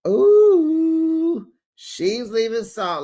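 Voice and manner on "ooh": high, loud ooh of surprise; a gasping high ooh at the gossip; surprised ooh. Real, not acted. Reacting to the news.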